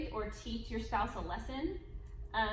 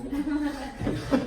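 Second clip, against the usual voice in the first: loud laugh; 4 semitones higher, 9 dB louder than usual. Real, not acted. Wife laughing at husband's teasing, loud laugh. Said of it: "You don't have to tell them it's real".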